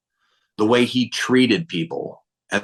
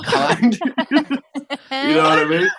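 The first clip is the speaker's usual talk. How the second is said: real laughing; 10 semitones higher, slower than usual. Real, not acted. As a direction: start earnest, break up laughing. Breaks into laughter mid-sentence.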